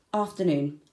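plain reading voice

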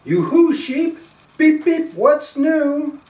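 high, loud sing-song call. Acted. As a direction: call across the field to the sheep. Truck calls out to sheep.

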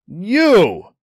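drawn-out startled exclamation; gasped recognition. A narrator's reading. Ryan recognizes the pirate woman, a startled gasp. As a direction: blurt it in astonished recognition. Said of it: "gasped Ryan".

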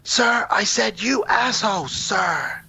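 high, ringing mock military reply; defiant cadet. Cadet answering the bully to his face, high defiant mock reply. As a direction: imitate a cadet boldly repeating the insult, pitched high.

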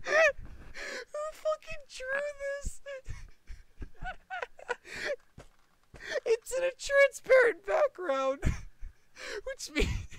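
high and loud, the words squeezed out through laughter; barely getting it out between fits of laughter; laughing hard. Real, not acted. Finds a ridiculous drawing, laughing hard.

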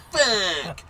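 loud, high cussing yell; mock frustrated shout; imitated kid's outburst. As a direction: yell it like a kid who missed a shot. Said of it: "Like that, you know?".

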